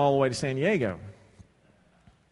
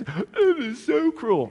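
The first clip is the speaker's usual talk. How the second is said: mock whine; 11 semitones higher, 7 dB louder, much slower than usual. Mock recruit complaint.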